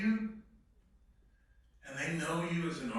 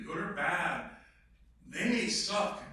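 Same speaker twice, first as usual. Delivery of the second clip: usual speaking voice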